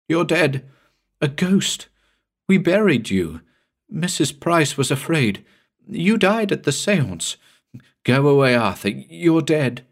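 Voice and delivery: high, frightened pleading; terrified moaning. Acted. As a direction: beg the ghost to leave, high and shaken. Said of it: "She moaned it over and over".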